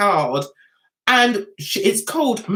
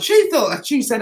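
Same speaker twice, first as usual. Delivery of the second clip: ordinary talk, nothing marked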